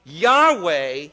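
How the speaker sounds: slow, loud, high cry of awe; drawn-out loud worshipful cry. Retold or imitated. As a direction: cry it out on your knees. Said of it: "he cried".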